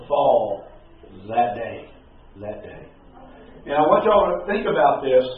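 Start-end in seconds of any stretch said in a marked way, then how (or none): 2.4-2.9 much softer repeat of "That day", almost breathed; soft echo of "That day" for weight; hushed echo. Shown as a demonstration. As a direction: let "That day" sink to a hush. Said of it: "hushed echo of the phrase".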